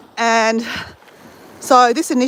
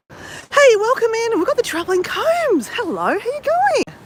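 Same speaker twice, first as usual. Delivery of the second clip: bright greeting; 11 semitones higher than usual. Real, not acted. Greeting livestream viewers brightly.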